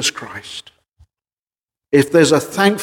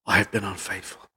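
much lower and much softer, grieving; with mourning; 6 semitones lower, 10 dB quieter than usual. Shown as a demonstration. Preacher showing the congregation how to confess, mournful and hushed. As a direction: confess it as a heavy, sorrowful admission. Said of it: "You should say it with a sense of mourning".

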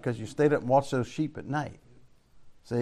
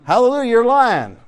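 loud, higher joyful hallelujah; excited shout; 8 semitones higher, 8 dB louder than usual. Real, not acted. Preacher answering a shout from the pews with a joyful shout. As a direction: call it out with glee to the congregation.

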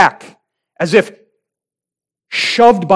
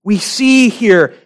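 higher, louder, driving climax; preaching at full force; 6 semitones higher, 4 dB louder than usual. Acted. Pastor to congregation at the climax, preaching at full force. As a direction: build the sermon to its peak.

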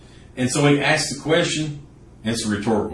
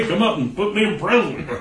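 higher, light mock-eager plea; mock cheerful request; 7 semitones higher, faster than usual. Preacher mocking a willing captive, higher, mock-eager. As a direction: play a silly prisoner asking to be locked up. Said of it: "Nobody willingly say".

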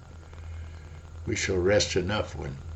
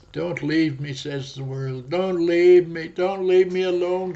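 higher, louder pleading; the world crying; 7 semitones higher, 6 dB louder than usual. Acted. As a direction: beg not to be left alone. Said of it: "cries the world".

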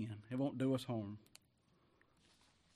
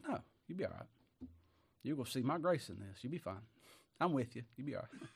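gentle reassurance; 6 dB quieter than usual. Acted. Lord comforting Paul, voiced softly.

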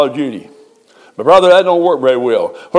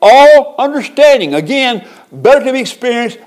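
soaring preacher's cry; exalted preaching; 8 semitones higher, 4 dB louder than usual. Acted. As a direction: lift the congregation with the verse. Preacher builds on scripture.

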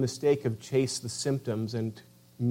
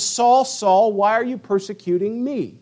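raised, louder call on the name; acted divine voice, pitched up and insistent; voice from heaven; 6 semitones higher, 4 dB louder than usual. The voice comes to Saul thrown to the ground, raised heavenly call. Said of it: "and then this voice comes".

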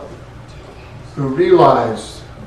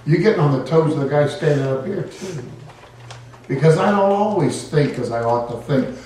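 ordinary delivery

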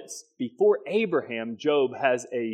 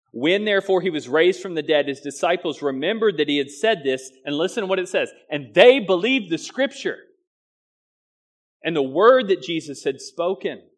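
higher and louder, ringing out; fervent scripture reading; 4 semitones higher, 6 dB louder than usual. Acted. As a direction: read it out like a proclamation. Scripture read with force.

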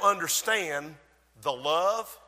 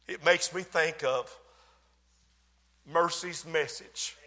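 normal talking voice

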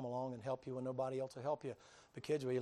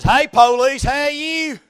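much higher, loud hearty greeting; Bubba's greeting; 12 semitones higher, 25 dB louder, slower than usual. Retold or imitated. Tow truck man greeting the officer, loud and hearty. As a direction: holler a big friendly hello. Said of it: "He says".

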